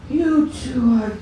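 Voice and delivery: a touch of starstruck gushing. Retold or imitated. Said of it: "she was like".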